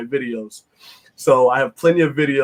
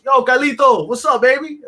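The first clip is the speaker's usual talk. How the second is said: fan's greeting; 9 semitones higher, 4 dB louder than usual. Retold or imitated. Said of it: "and he goes".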